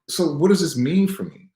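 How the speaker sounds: rising, desperate question; anguished outburst. Retold or imitated. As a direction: cry it out to the doctor. Despairing retold plea.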